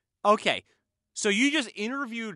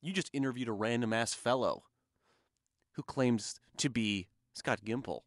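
low conspiratorial voice; 11 semitones lower, 10 dB quieter than usual. Pretend. Mock conspiracy accusation.

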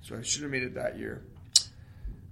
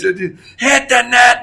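furious drawn-out yell, high and loud; angry coach shout; 12 semitones higher, 17 dB louder, much slower than usual. Retold or imitated. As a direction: bellow it across the rink like a mad coach. Coach yelling at players in practice.

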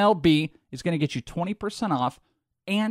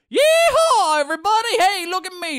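mock Texas cowboy; 14 semitones higher, 5 dB louder than usual. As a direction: ham up a yeehawing cowboy. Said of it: "you're like".

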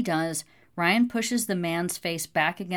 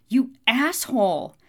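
high, loud outburst; outraged cry; 7 semitones higher, 7 dB louder than usual. A narrator's reading. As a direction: cry out in outrage, pitch jumping up. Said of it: "she starts to cry out".

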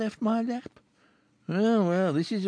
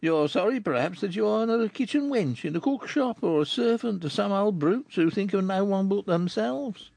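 mildly mocking rough man; ironic; 11 semitones higher than usual. Chourineur mocks the girl, mildly ironic. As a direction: needle her with light irony.

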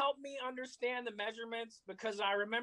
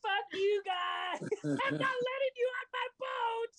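gloating shout; 10 semitones higher, slower than usual. Retold or imitated. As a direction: crow it over them at the top of your voice. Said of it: "and he's like".

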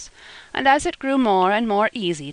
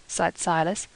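plain reading voice

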